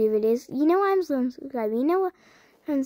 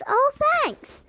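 squeaky thanks; 12 semitones higher than usual. Acted. High squeaky reaction to comment.

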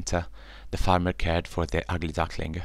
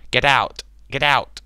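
loud, higher angry cat; hissing tomcat; 8 semitones higher, 8 dB louder than usual. Acted. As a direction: shout him out, cat snarling. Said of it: "hissed the tomcat".

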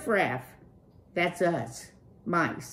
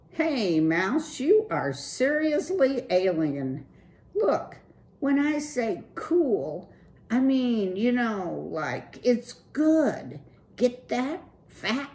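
higher, loose slacker-dude voice; Clutch, cool dude; 8 semitones higher, 5 dB louder than usual. Acted. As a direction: play the laid-back streetwise rocker.

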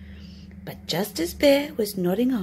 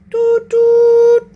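loud, high train toot; train whistle; 13 semitones higher, 14 dB louder than usual. Acted. Fox imitating a train whistle to sell the cave.

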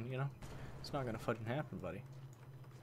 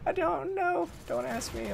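squealing laugh into high, giddy words; high giggle; slower than usual. Real, not acted. Laughs at lopsided score.

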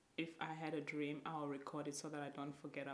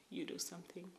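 ordinary delivery

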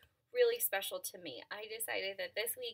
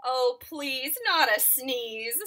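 pleading bear; 4 semitones higher, 8 dB louder, slower than usual. Acted.

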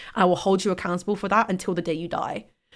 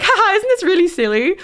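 laughing quote; 10 semitones higher, 5 dB louder than usual. Retold or imitated. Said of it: "we were both like".

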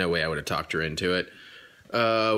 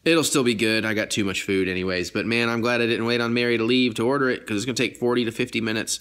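ordinary talk

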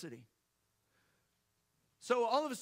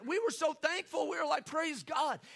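excited thanks; 7 semitones higher than usual. Real, not acted.

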